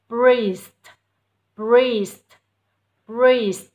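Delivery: "Priest" very loud, closing on a clear st; final double consonant. Shown as a demonstration. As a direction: punch "Priest" and finish on both s and t. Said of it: "kết thúc bằng phụ âm đôi". ("Priest" đọc to, bật rõ "st" cuối)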